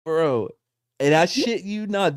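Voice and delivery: normal talking voice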